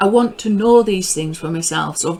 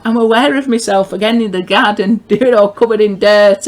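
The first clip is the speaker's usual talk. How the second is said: louder, laughing through the words; amused laughing; 3 semitones higher, 8 dB louder than usual. Real, not acted. Back in the garden covered in dirt, amused laughing. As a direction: let a real laugh break into the telling. Amused at finding herself muddy.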